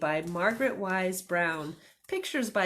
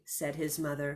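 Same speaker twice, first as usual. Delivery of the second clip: ordinary delivery, nothing marked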